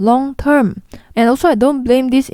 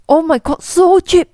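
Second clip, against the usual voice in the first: higher, louder squeal of delight at a bargain; mock thrilled bargain hunter, pitched up; excited shopper; 7 semitones higher, 3 dB louder than usual. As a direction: gush over the cheap price. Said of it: "most people will be like".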